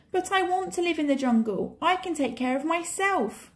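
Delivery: put-on high, insistent boy's plea; crying out boy. A narrator's reading. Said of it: "cried Mowgli".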